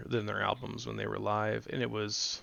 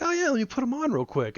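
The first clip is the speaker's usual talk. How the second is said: much higher, bright mock eagerness; mock eager high voice; 4 dB louder than usual. Eager to share a band with a newcomer, mock high voice. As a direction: play the overexcited fan, pitched way up.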